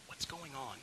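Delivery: whispered, bewildered question; stunned whisper; hushed question. Acted. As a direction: ask under your breath, unsure what happened. Mom asks, stunned at breakdown.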